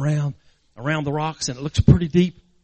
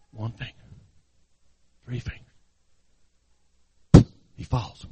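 hushed suspense; 6 semitones lower than usual. A narrator's reading. Preacher narrating the boy's last fingers slipping, hushed. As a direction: drop to almost nothing before the fall. Suspense before the boy falls.

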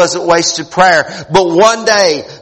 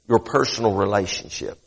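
lowered, intimate closing line; hushed; 12 semitones lower, 11 dB quieter than usual. Acted. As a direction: drop the voice to let it sink in. Hushed closing sermon point.

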